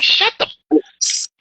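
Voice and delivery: strained raspy whisper, mocking a tough-guy hero; hammed-up gravel-throated whisper impression; fake laryngitis voice. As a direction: rasp it out like a badass superhero with laryngitis. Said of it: "he kept talking like this".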